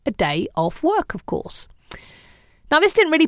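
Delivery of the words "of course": a dry, whispered of course; wry whispered aside. Real, not acted. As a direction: drop to a knowing whisper. Wry aside after a point.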